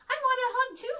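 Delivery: high squeaky bird ask; eager Bird. Acted. Bird asking Bear for a hug, high and eager. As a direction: chirp it up high.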